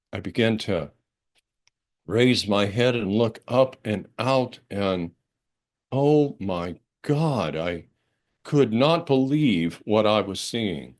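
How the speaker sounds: normal talking voice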